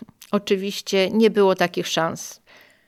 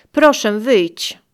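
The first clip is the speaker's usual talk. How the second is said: slow, louder, insistent request; firm voice; 2 semitones higher, 8 dB louder, slower than usual. Retold or imitated. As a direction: leave no room to argue. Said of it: "powiedziała stanowczym głosem". (stanowczo, głośniej i wolno)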